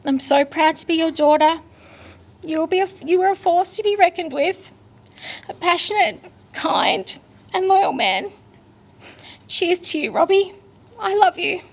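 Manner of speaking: high, tearful address to her late dad; emotional farewell. Real, not acted. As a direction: hold back tears while saying goodbye.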